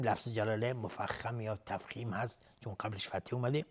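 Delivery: ordinary talk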